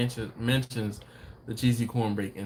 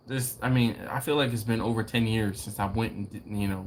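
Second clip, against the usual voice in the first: normal talking voice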